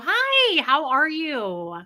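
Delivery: very high, drawn-out friendly hello; sing-song, over-friendly hi; purposely warm greeting. Retold or imitated. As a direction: gush a greeting to cheer up a worn-out worker. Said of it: "I purposely try to, like, warm them up".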